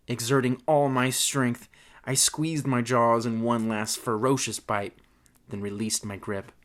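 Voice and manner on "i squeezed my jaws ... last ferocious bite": plain reading voice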